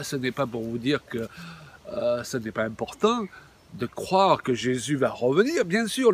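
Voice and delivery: usual speaking voice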